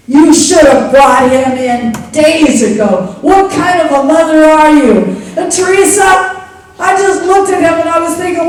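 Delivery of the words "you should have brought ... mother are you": loud, harsh reproach from the doctor; scolding doctor. Retold or imitated. Doctor shouting at the young mother. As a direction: scold her like a stern doctor.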